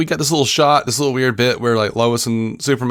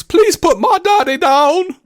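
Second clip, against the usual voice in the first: loud, high, drawn-out mock child scream; screaming kid, weird accent; 4 dB louder, slower than usual. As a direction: imitate a kid wailing in an odd accent. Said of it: "this kid screams".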